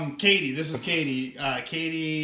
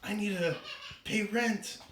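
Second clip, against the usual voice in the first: soft, slightly raised worried voice; lightly voiced bookseller; 3 semitones higher, 5 dB quieter than usual. Bookseller fretting to her cat about rent, soft and lightly acted. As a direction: fret quietly to the cat.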